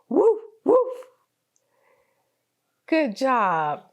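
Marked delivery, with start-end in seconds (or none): 0.1-1.0 high playful woof; dog bark imitation. Shown as a demonstration.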